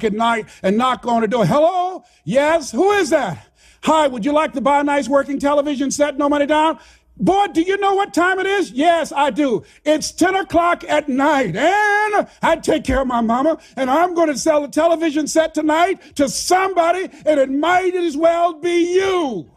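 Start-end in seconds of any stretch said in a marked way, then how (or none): 1.5-6.8 high, bright, fast door pitch; eager salesman pitch. Retold or imitated. Selling TVs door to door. 7.2-8.7 high, scolding voice at the door; annoyed resident. Retold or imitated. 8.7-19.5 determined shout. Retold or imitated. Boy defiantly insisting on a sale.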